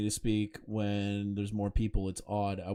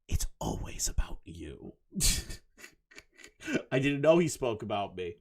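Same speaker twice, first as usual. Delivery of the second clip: ordinary talk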